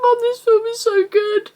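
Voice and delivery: high tearful wail, reliving it; crying to mum. Retold or imitated. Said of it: "go and cry to my mum".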